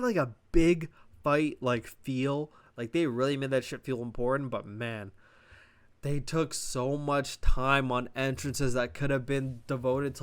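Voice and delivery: normal talking voice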